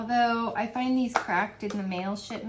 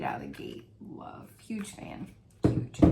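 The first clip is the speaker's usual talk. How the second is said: hushed, breathy whisper of delight; whispered awe; 5 dB quieter than usual. Real, not acted. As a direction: lean in and whisper it like a secret. Excited aside about the scent.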